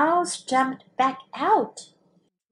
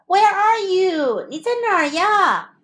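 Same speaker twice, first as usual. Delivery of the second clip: calling out; 7 semitones higher, 3 dB louder than usual. A narrator's reading. Searching for the hidden moose.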